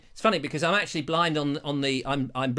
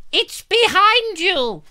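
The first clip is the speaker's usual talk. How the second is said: high, loud, drawn-out audience cry; pantomime shout; 7 dB louder, much slower than usual. Acted. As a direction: yell it slowly like a panto crowd.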